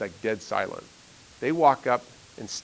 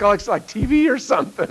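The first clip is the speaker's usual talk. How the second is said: excited classmates; 9 semitones higher, 7 dB louder than usual. Retold or imitated. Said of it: "they're like".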